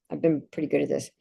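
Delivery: normal talking voice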